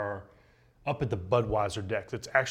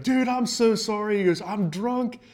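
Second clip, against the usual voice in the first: put-on drunk guy; 9 semitones higher, 4 dB louder than usual. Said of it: "He's like".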